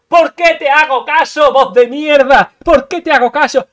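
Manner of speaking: loud, furious shouting at himself; angry outburst. Real, not acted. Gamer yells at his own inner voice. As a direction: rage at the voice that tricked him.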